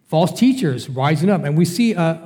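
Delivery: usual speaking voice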